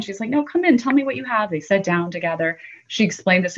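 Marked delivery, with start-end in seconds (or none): 0.3-1.5 higher, kind welcoming voice. Retold or imitated. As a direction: welcome her in kindly. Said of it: "the woman was so kind".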